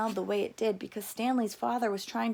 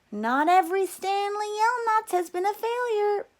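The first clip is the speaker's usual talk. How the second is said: higher, louder, hopeful mother insisting; mother's voice; 11 semitones higher, 7 dB louder than usual. A narrator's reading. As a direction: play the mother defending the family. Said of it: "Stanley's mother often pointed out".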